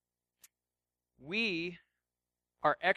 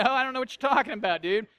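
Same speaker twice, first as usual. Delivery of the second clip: quick, raised, louder bewilderment; baffled protest; 4 semitones higher, 6 dB louder than usual. Acted. Non-coffee drinker to a coffee snob, comically baffled. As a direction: throw up a fast, mock-baffled objection.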